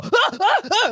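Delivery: short high-pitched yelps of panic; acted-out panicked shrieks, not full volume; retold scream. Kid watching brother's garden fire go up, retold panicked yelps. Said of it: "I go".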